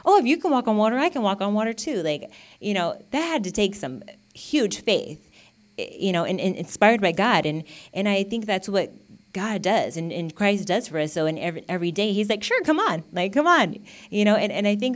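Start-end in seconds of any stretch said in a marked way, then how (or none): 0.0-2.0 high, eager bragging quote; eager mock Peter. Peter deciding he can walk too, eager. Acting out Peter's bold idea. 12.4-13.8 high, eager urging; encouraging. Acted. God inviting us every day, eagerly encouraging. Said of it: "encourages us".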